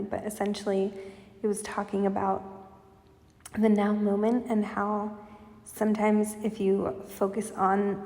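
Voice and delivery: ordinary talk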